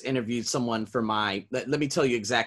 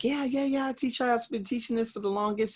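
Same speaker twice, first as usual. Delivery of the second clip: much higher, keen yeah yeah yeah; eager reply; 11 semitones higher, 3 dB quieter than usual. Retold or imitated. Teacher eagerly answers his student, much higher. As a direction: jump in eagerly, pitched way up. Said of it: "I'm like".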